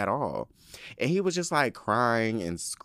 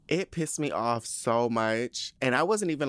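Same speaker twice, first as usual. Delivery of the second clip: ordinary talk, nothing marked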